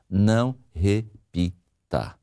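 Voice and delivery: "não repita" very slowly, syllable by syllable; slow emphasis. Real, not acted. Coach pleading with the listening wife, "não repita" slowly syllable by syllable. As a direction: spell out "não repita" in separated, measured beats. Coach spells out rule to wives.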